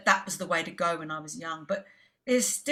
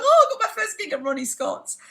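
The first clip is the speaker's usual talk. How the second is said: thrilled squeal of joy; 13 semitones higher, 5 dB louder than usual. Retold or imitated. Retelling joy at first gig.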